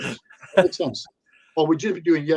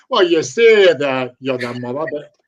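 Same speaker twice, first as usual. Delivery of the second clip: mock therapist; 8 dB louder, slower than usual. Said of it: "Could you imagine if a therapist turned around and went".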